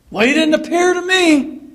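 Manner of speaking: jealous huff, he never came to me; indignant doubter. Retold or imitated. A doubting disciple protesting to Peter, indignant. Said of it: "the doubting of the disciples".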